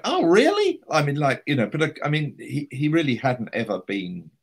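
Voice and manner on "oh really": very high, loud, amazed yelp; mock surprise. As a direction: squeak it out, genuinely astonished. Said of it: "he went".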